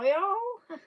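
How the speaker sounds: high, drawn-out Southern "y'all"; Southern accent. Shown as a demonstration. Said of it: "I definitely sometimes have an accent".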